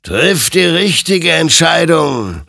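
high, loud, slow admonition; eindringliche Ermahnung. A narrator's reading. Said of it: "ermahnte ihn sein Gegenüber". (laut und gedehnt ermahnend)

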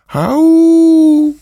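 long, high, loud doggy howl; puppy howl. Acted. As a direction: howl out your misery like a pup. Puppy howls over lost clouds.

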